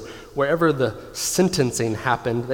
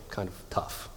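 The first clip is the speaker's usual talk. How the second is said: breathy hushed "kind of tough", almost no voice; whispered aside; 4 semitones lower, 11 dB quieter than usual. Real, not acted. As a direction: drop to a whisper, rueful. Sad aside about daughter.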